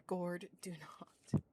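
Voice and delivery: ordinary talk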